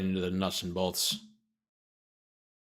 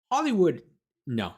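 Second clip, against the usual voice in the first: high, louder mock-offended protest; mock indignant; 6 semitones higher, 4 dB louder than usual. Real, not acted. Protesting the article that blames Hollywood, mock indignant. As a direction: scoff at the blame, pitched up and sharp. Reading claim blaming Hollywood.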